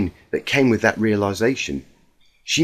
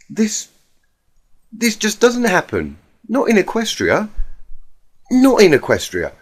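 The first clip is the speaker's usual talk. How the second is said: stunned denial; 8 semitones higher than usual. Acted. Twilight refusing to believe.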